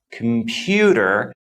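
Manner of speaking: "computer" slow, with PEW drawn out; stress on second syllable. Shown as a demonstration. Teacher showing learners where stress falls, "computer" stressed on PEW. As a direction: hit and lengthen the middle syllable of "computer". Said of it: "the stress falls on the second syllable".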